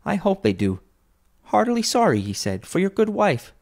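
high, animated father's voice; earnest Bob quoting. A narrator's reading. Bob quotes the nephew's sympathy to his wife, animated and earnest. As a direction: retell the kind words with lifted pitch.

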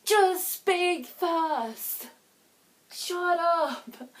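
a bystander losing patience; annoyed. Pretend. Bystander fed up with callers talking over each other, annoyed. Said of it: "someone in the room that just goes".

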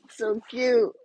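so cute, squealed way up high. Real, not acted. Gushing over the troll to her viewers, high squeal. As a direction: squeak it out in delight.

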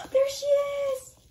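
high, drawn-out squeal of delight; excited squeal. Real, not acted. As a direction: squeal it slowly, overjoyed. Delighted squeal at seeing toy.

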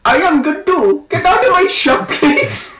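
high-pitched accented cartoon king; King Julian. Cartoon king ordering someone out of the shop, high comic accent. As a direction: squeal it slowly at the top of your range. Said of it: "my name is King Julian".

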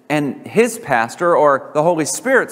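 normal talking voice

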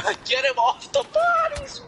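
high, loud hyped yell; hyped gamer bro. Real, not acted. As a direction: yell it hyped at your teammates. Hyped gamer yelling at teammates.

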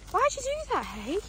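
high, fond pet-talk question; talking to the dog. Real, not acted. Owner asking her dog about its scratching, high pet-talk. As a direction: ask the dog in a high doting voice.

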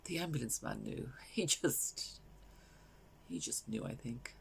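grief-stricken, hushed, long breathy pauses; Valerie. Acted. Bereaved mother recalling saying goodbye to her daughter, hushed grief. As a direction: let it sink softly to a near whisper. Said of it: "this is a character called Valerie".

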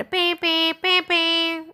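high, drawn-out fish seller's horn honks; imitated horn. Teacher mimics the fish seller's horn for a child, high honking. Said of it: "ആ ഒരു sound കേട്ടു".

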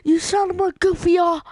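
high, loud mock yell; mock insult yell. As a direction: bellow it like a cartoon insult. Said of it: "He's like".

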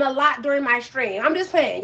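normal talking voice

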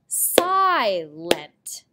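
"Silent" with a strong, drawn-out first syllable; first-beat stress. Shown as a demonstration. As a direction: hit and hold the "si" in "Silent". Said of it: "The first clap out beat. Watch".

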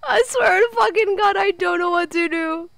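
high, panicked whimpering; real tearful fright; so scared. Real, not acted. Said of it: "I'm so scared".

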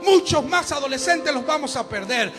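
loud, driving sermon voice; fiery pulpit preaching; preaching at full force. Acted. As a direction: preach it hard to the congregation.

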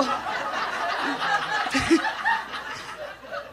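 rising airy laugh. Real, not acted. Laughing at story.

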